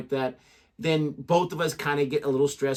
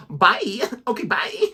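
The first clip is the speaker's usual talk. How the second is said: phone voice goodbye; 6 semitones higher, slower than usual. Retold or imitated. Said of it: "no one talks that way".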